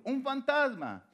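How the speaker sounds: high, drawn-out cry, read aloud; cry of fear. A narrator's reading. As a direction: read it as a mildly alarmed cry. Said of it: "dieron voces de miedo".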